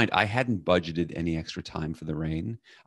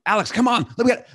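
much higher, louder impatient hurry; testy and loud; 6 dB louder, faster than usual. Retold or imitated. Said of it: "I start to get testy and loud".

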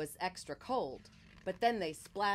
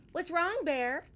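high, loud little bird voice; 10 semitones higher, 5 dB louder than usual. A narrator's reading. Bird asks sad Bear what is wrong, in a high voice. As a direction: chirp the question gently like a bird. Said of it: "asked a voice. It was Bird".

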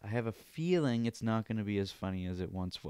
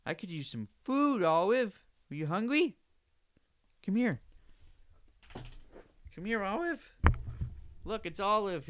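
high, sing-song pet voice, much higher; 9 semitones higher than usual. Real, not acted. Talking to his dog Olive in a high coo.

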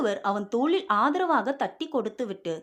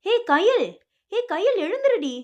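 higher, louder urgent calls to his fainted wife; frantic calling; 8 semitones higher, 5 dB louder than usual. A narrator's reading. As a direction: shake her awake with your voice. (பதற்றமான அழைப்பு)

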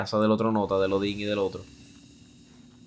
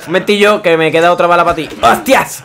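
excited yell; 8 semitones higher, 14 dB louder, faster than usual. Real, not acted. Yelling mid-fight in game.

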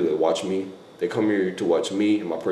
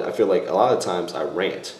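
ordinary delivery

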